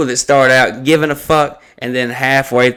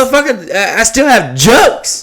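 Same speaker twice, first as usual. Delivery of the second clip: retold retort; 9 semitones higher than usual. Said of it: "I'm like".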